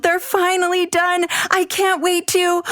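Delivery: put-on high, bright eager child voice; can hardly contain herself; excited child. Abby seeing the cookies done, excited.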